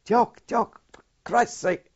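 a high, loud Scottish plea from a wounded mate; Scottish accent. Retold or imitated. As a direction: call out in a Scots voice, begging. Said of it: "he's a Scot, real Scot".

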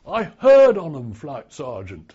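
a light imitated Welsh accent, loud start; lightly voiced Welshman, Welsh accent. Welsh recruit answering the sergeant in a light Welsh accent. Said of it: "he said in Welsh accent".

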